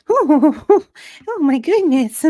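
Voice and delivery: high, loud, giggly fluster; flustered Kate. Acted. Kate flustered by Liam's compliment, high nervous squeak. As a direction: squeak it out, caught off guard.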